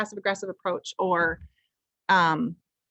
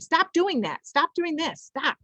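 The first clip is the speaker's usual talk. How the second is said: nagging stop; 9 semitones higher, slower than usual. Shown as a demonstration. Parent scolding a child with repeated stops, nagging. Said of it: "not saying".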